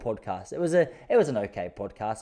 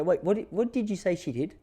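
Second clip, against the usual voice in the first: shocked disbelief; 8 semitones higher than usual. Retold or imitated. Shocked reaction to friend's story.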